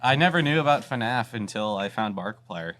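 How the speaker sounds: ordinary talk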